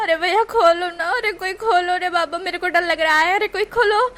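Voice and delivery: high, loud hammed-up wailing sobs; fake crying. Wing pretending in the lift, loud faked crying. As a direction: fake sob for your mother. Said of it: "वो pretend करते हुए कहता है".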